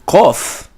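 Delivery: Kof with a k from far back in the throat; deep kof. Shown as a demonstration. Teacher showing the back-throat kof. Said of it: "is at the back of the throat".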